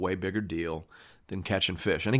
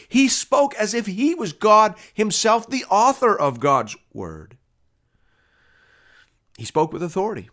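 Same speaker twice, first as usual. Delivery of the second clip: emphatic preaching; 9 semitones higher, 4 dB louder than usual. Real, not acted. Preacher declares Jesus spoke as God himself, emphatic climax.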